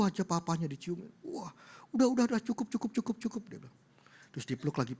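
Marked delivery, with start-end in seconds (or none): 1.3-3.4 high, rapid-fire string of pleas; flustered protest. Retold or imitated. Father smothered in kisses, flustered protest. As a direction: call a halt, word after word.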